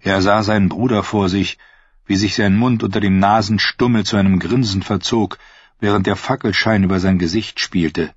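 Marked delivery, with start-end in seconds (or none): none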